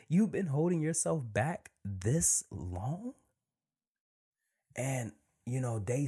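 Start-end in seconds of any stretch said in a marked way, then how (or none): 0.1-3.1 high, slow, laughing disbelief; slow amazed self-talk. Retold or imitated. Host retelling his own thought, slow and amazed. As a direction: stretch it out, stunned at yourself. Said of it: "thinking to myself".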